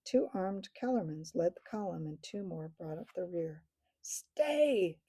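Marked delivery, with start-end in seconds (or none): 4.0-5.0 high, called-out stay; thundered. Acted. King halting the soldiers on the road, loud command. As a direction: call the halt. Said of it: "thundered Tyrion".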